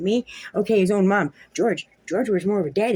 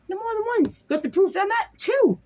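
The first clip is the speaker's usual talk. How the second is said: high, heated outburst pitched up; rising anger; 13 semitones higher than usual. Real, not acted. Ranting at the ex over lies under oath, higher and heated. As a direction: let it spike up in outrage.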